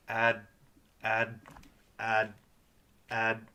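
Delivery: "Ad" cut off right at the D; stopped final D. Shown as a demonstration. Teacher correcting learners, D stops the sound short. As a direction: repeat "ad" singly, no vowel after D. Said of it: "The D stops the sound".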